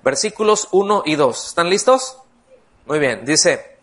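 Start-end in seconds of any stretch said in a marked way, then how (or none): none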